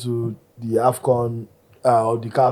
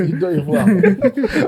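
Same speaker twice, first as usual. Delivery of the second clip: higher, laughing through slow words; 7 semitones higher, slower than usual. Real, not acted. Host signing off to cohosts, laughing through the words. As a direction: crack up while signing off.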